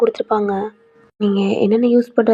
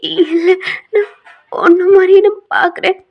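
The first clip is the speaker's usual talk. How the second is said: pleading; 8 semitones higher, 6 dB louder than usual. Acted. Heroine pleads to work same job.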